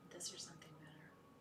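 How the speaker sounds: hushed, unvoiced whisper of the mantra. Real, not acted. Leading the circle in a whispered mantra, hushed. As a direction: breathe the words like a secret.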